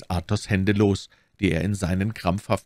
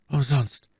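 higher, hopeless exclamation; despairing; 6 semitones higher than usual. A narrator's reading. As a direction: let it fall hopelessly. Athos despairs of any cure.

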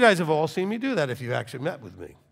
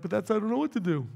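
slightly high, quick, put-on protest; imitated helpless protest; 5 semitones higher, faster than usual. Congregant who comes for advice, mock helpless and quick. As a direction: mimic someone helpless, hurriedly. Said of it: "You're like".

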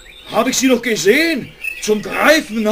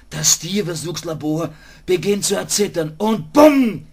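ordinary talk, nothing marked

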